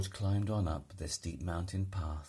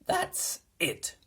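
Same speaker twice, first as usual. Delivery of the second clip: exhausted cry; 5 dB louder than usual. A narrator's reading. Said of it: "he cried".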